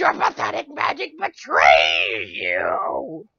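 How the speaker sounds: low, slow, shouted villain taunt; mock-menacing low boss shout. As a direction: boom it out like a game boss gloating. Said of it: "how annoying her voice was".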